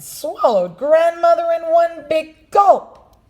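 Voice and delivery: high, loud dramatic telling; dramatic shout. A narrator's reading. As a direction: boom out the gulp. Narrator dramatizes the gulp.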